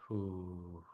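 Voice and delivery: slow breath out through pursed lips; long exhale. Shown as a demonstration. Teacher showing listeners the breathing, long pursed-lip exhale. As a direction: blow the air out softly and steadily. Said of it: "through your pursed lips, like this".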